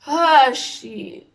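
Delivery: high, loud groan of realisation; dawning dread. Retold or imitated. Vlogger realising she is in trouble, dawning dread. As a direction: let the "ah" burst out high.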